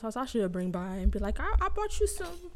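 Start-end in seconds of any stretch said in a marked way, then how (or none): 1.4-2.5 sweet offering voice. Retold or imitated. Acting out offering to grandma.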